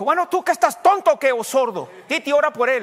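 very high, loud exasperated scolding; scolding shout. Retold or imitated. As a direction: yell at him like he's a fool. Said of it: "Y mamá Belén dijo". (mamá Belén regaña a gritos, muy aguda)

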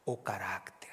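sudden soft whisper on the last words. Real, not acted. Pastor finishing the verse to the congregation, whispered.

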